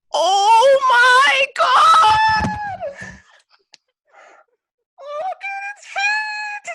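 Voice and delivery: shrieking, very high, slow squeal of delight; freaking out about puppies. Retold or imitated. [name] imitating Jenna freaking out over puppies, squealing. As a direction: scream it like you just saw a puppy. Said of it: "me freaking out about puppies".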